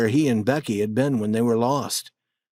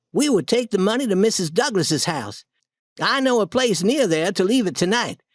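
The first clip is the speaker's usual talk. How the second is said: put-on higher boyish voice, brisk; lightly voiced boy laying out plans; planning Tom; 8 semitones higher than usual. A narrator's reading. Tom plans hiding the money for the night, higher boy voice.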